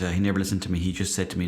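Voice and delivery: ordinary delivery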